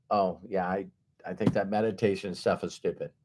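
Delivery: low, soft, quick mumble; acted offhand scoffing, low and hurried; dismissive sceptic. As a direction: brush it off under your breath. Voicing a dismissive meditation sceptic.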